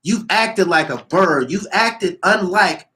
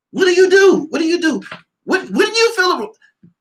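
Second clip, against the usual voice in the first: much higher, louder disbelieving shout; shrieking disbelief; 14 semitones higher, 4 dB louder than usual. Real, not acted. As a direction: scream the question in disbelief. Incredulous rant peaks.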